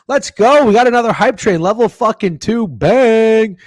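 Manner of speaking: high, loud hype; hype cheer. Real, not acted. Streamer cheering chat's hype train, loud and high.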